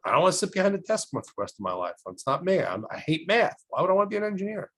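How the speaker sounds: fast, rising retold protest; protesting student. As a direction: argue back, pitch climbing. Student argues back at nun.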